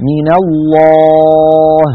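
الله with a dark, heavy lam and alif. Shown as a demonstration. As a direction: stretch الله, lam full. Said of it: "لفظ الجلالة لامه مفخمة". (تفخيم لام الجلالة)

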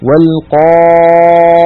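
والقائمين with a dark, full alif after the ق; heavy alif. Shown as a demonstration. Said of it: "لاحظوا ال-، الألف والقاف التي قبلها". (ألف مفخمة بعد القاف)

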